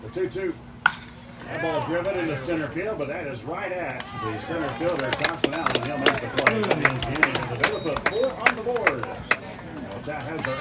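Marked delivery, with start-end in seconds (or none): 0.0-9.1 rising excited sports call, getting louder; excited play-by-play. Acted. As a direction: build the call to a loud peak. Live home run call.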